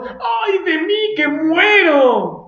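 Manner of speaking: high, slow shouted cry of doom; terrified wail. Retold or imitated. Isaiah before the throne of God, shouted slow wail. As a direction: cry out as if facing death. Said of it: "viendo el trono de Dios y diciendo".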